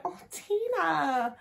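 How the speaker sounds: high, slow, drawn-out cooing; fond pity. Retold or imitated. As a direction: coo it like pitying a hapless favourite. Retold fond pity for Tina.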